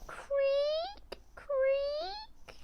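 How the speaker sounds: high whispered creaking noise; creepy creak. A narrator's reading. Noises under the bed creaking in a spooky whisper. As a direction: breathe it like a spooky floorboard.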